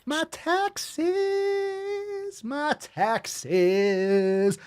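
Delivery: high, nasal-free mocking whine of my taxes; mock whiny complainer. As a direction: sneer it like a petty tax whiner. Mocking a whiny fat-shamer.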